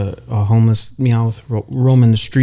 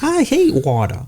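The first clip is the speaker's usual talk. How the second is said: high cartoon voice; 13 semitones higher, slower than usual. Continuing the Meowth bit.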